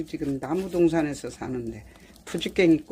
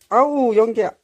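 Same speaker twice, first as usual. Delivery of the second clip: much louder cry of annoyance at the smoke; loud smoke complaint; 4 semitones higher, 12 dB louder than usual. Real, not acted. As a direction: shout it out, fed up with the smoke. Choking on smoke. (연기에 질려 크게 외치듯)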